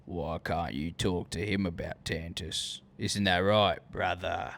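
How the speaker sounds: lightly voiced menacing soldier; bad clone impression. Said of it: "I can't do a good clone impression".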